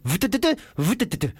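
stuttering buzz and tick; imitated friction noise. Comic imitating dry friction, juddering mouth noise. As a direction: sputter out a rhythmic juddering noise. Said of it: "It's like".

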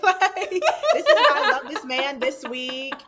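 loud confession through laughter; high, loud, self-mocking gush; laughing mock rant. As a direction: crack up while imitating your own rant. Mocking own live confessions, laughing.

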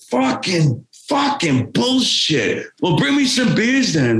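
high, slow cursing rock-star grumble. Retold or imitated. [name] refused champagne.

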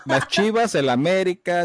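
high, loud and giggly; laughing. Real, not acted. Podcast host naming rival clubs with his guest, laughing high and loud. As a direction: toss the team names out through laughter.

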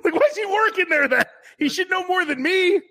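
very high-pitched mock indignation; incredulous complaint. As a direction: squeal the complaint in disbelief. Indignant retort within a retold mishap.

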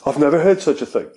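hammed-up mock British accent; cheap UK accent. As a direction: do a jokey, badly faked British accent. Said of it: "my fucking cheap-ass U K accent".

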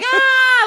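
sudden high loud yell while blowing out smoke; mock scream. Friend retelling the show, the teacher's smoking scream as a mock yell. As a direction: belt out a wild imitating scream. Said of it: "screams and while he's blowing smoke".

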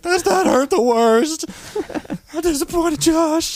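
high, drawn-out imitation of crying; mock bawling. Friend crying over being disappointed in Josh, mock bawling. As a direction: wail it in a squeaky fake sob. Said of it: "he just starts crying. He's, like, bawling".

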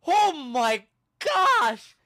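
loud, high, drawn-out mock horror; big retold exclamation of shock; shocked outcry. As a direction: act out an onlooker's horrified gasp of words, stretched out. Friend's shocked reaction to injury, acted.

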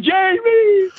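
high calling out a name; mock yelled name; hollering. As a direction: holler for someone down the lots. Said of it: "He's hollering".

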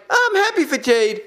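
high, fake cheerful voice; mock happy Tony Khan. Commentator mocking a rival boss's fake cheer, high. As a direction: parrot a forced smile. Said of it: "he could be all like".

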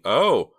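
loud, high frightened gasp; girl gasps seeing the killer at the door. A narrator's reading. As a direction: gasp at the sight of him. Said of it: "she gasped".

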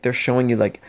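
normal talking voice

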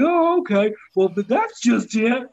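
high, startled oh okay; mock surprise. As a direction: feign being caught off guard. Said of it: "I'm like".

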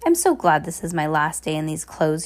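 plain reading voice

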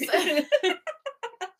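high bursting laugh. Real, not acted. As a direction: laugh out loud.

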